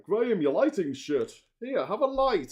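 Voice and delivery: loud, high mock exclamation; imitated blunt donor. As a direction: call it out as a blunt well-wisher. Acting out donors' blunt words.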